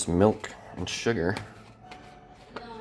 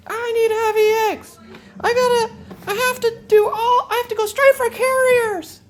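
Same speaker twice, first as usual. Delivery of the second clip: very high, laughing whiny mock voice; mocking whiny players; 4 dB louder than usual. Streamer mocking players who insist on carriers, whiny mock cry. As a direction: ham up a crying whiner. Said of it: "all you guys out there who are like".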